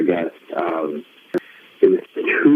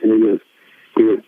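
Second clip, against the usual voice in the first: ordinary talk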